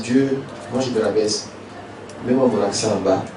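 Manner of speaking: low, soft, humble answer to God; humble reply. Acted. Knowledge humbly answering God where its accent goes, low humble reply. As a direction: say it lowly, submitting to God.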